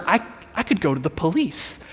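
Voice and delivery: hushed, halting wonder; quiet, tentative acted discovery; dawning realization. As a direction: say it softly as the idea sinks in.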